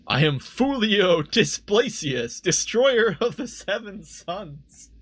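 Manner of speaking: high, loud, drawn-out proclamation; slow, theatrical self-introduction; grand proclamation. Acted. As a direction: announce yourself like a pompous villain. Reads grand villain name aloud.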